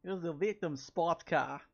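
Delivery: loud, hammed-up Boston accent. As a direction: belt it in a thick Boston accent. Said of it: "that was a brutal Boston accent".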